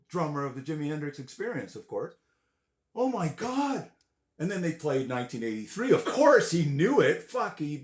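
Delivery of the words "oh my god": higher, hushed oh my God; mock stunned; awed. Realizing who is on drums, stunned.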